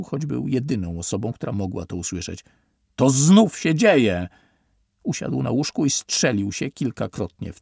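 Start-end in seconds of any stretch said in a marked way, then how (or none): 3.0-4.3 slow, loud, higher cry of alarm; he can't believe it's happening again; panicked exclamation. Acted. Panicked realization. (przerażony, głośny okrzyk)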